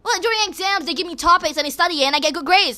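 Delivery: fed-up outburst; angry rant. Real, not acted. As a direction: tell the critics off, heated. Continuing heated rant.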